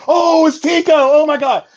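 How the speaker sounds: high, loud excited squeal; mock a fan spotting him in public; excited fan recognizing him. Fan spotting Tico in public, shrieking with excitement. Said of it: "Unless you just see me go".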